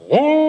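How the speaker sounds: sudden high loud "¡Oh!" to scare; a spooky boo in the dark pantry; scary ghost shout. Acted. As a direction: jump out and boo her with a high howl. Superior scares the old nun.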